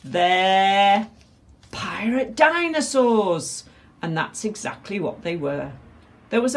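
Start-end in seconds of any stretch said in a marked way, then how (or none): 0.0-3.6 loud, high, stretched-out announcement; dramatic reveal. A narrator's reading. Flynn reveals the enemy to crew, dramatic cry. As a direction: hold the first word, then build higher.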